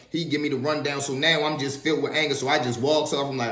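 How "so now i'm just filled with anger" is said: normal talking voice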